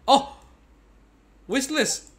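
high, loud burst of delight; excited outburst. Real, not acted. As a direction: shout it out, thrilled by the find. Finds the wish list.

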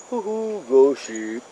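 playful ghostly hoot, starting high; mock-spooky. Vlogger jokes about a ghost ship, mock-spooky.

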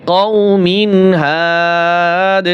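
slow recited হাদ stopped with an echoing দ; waqf pronunciation. Shown as a demonstration. Teacher demonstrates stopping on the word, slow qalqala ending. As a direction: stretch কও মিন হাদ slowly and bounce the final দ. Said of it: "তাহলে এইভাবে হবে".

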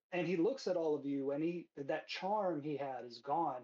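ordinary talk

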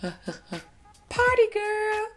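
put-on high squealed party girl cheer. Makeup vlogger cheering as a party girl. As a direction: squeal it out, stretched and laughing.

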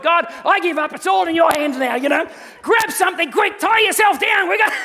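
a husband in a storm gives up and shouts, rising panic; panicked cry to God. Retold or imitated.

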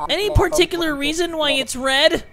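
high, put-on Christopher Robin voice; wimpy kid. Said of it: "I made him sound like a little bitch".

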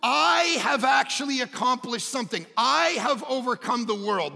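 loud, high-pitched sermon climax; full-force preaching. Real, not acted. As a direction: proclaim it with all your force.

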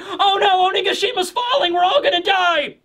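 high, hammed-up panicked wail; mock panic. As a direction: ham up the doom cry, high.

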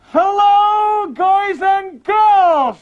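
loud, high dummy greeting, dragged out slowly; dummy shouting a slow hello; ventriloquist dummy voice. Acted. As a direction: bellow hello as the wooden dummy. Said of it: "You never saw my lips move".

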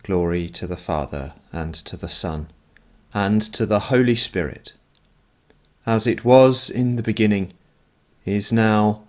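ordinary talk

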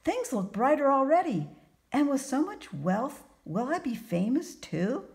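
high, loud, hopeful character voice; eager young carpenter, excited. A narrator's reading. Carpenter asks fortune teller about fame, eager excitement. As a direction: press on excitedly toward the next question.

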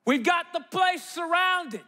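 high, projected army leader on a megaphone; megaphone announcement. Acted. Army leader to the surrounded Elisha and servant, megaphone command. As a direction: call it out like a police standoff. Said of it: "pulls out a megaphone and says".

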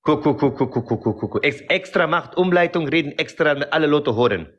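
put-on heavy foreign accent, broken grammar; broken-German accent. Character answering the interviewer in broken accented German. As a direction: play a Kurdish immigrant in broken German.

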